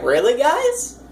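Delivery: a DM sighing at their players' choices; DM's mock exasperation at the players; exasperated disbelief. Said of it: "I'm just sitting here like".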